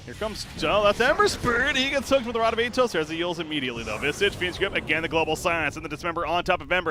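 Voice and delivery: live esports play-by-play. Real, not acted. Caster to viewers as Ember gets hooked, excited play-by-play. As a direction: burst in high, then keep the call lively.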